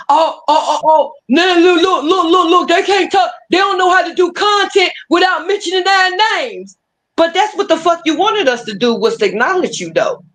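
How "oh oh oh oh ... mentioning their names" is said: sneering impression of the rivals bragging; mocking whiny gloat. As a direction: ham up the rivals' taunting. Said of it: "be like".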